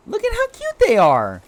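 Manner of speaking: put-on cute baby voice. Cooing over cute creature.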